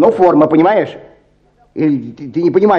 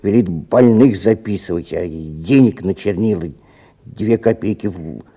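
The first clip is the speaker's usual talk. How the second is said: ordinary delivery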